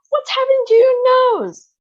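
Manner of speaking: high, loud panicked mother voice; alarmed mother. A narrator's reading. Mother panics at her daughter's blue nose, high and loud. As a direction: cry out in shock as a frantic mother.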